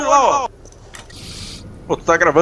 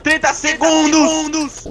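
desperate raised plea; man down shout; 4 semitones higher than usual. Real, not acted. Downed, begging teammates for help.

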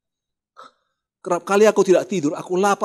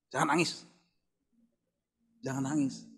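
quiet imitation of a wife's reproof; hushed admonition; 10 dB quieter than usual. Wife hushing husband during prayer, quiet retold admonition.

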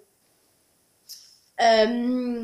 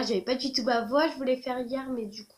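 ordinary talk, nothing marked

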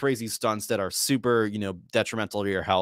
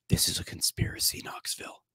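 hushed conspiratorial whisper, no voice; paranoid whisper; 8 dB quieter than usual. Retold or imitated. Sami Zayn whispering to Knoxville, paranoid whisper. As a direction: lean in and whisper the accusation.

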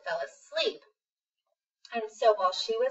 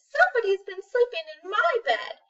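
higher, lightly voiced mama bear; a touch of a fussy mother bear; 10 semitones higher, 4 dB louder than usual. Mama bear finds her messy bed, lightly higher voice. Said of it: "And Mama Bear says".

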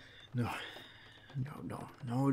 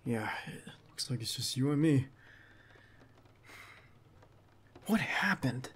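hushed whisper. Acted. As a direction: whisper it close, still wary. Alone together after the fight.

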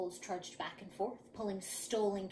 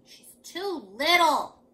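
loud, drawn-out high complaint; grumbling child; 5 semitones higher, 11 dB louder, slower than usual. A narrator's reading. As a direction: dig in with a slow, whiny protest. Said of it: "he grumbled".

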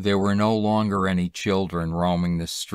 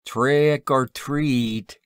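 high, drawn-out trick-or-treat cry; chattering hobos; 7 semitones higher, 4 dB louder, much slower than usual. A narrator's reading. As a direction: hobo trio's slow, high-pitched begging call. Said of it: "chattered a trio of hacking, sniffing hobos".

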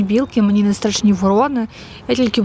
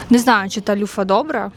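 ordinary delivery

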